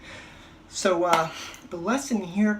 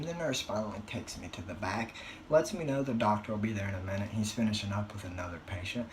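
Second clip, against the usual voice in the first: ordinary talk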